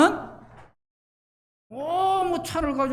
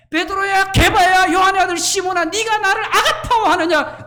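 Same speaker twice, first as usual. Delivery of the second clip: rising, fervent call; 6 semitones higher, 4 dB louder than usual. Retold or imitated. 예수님의 질문을 높여 외침.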